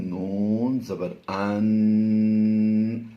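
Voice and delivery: ان held long through the nose, very slow; nasal ghunna. Shown as a demonstration. Teacher showing the ghunna sound slowly. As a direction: hum the نون as a long ghunna. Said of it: "یہ غنہ آنی کی آواز دے گا".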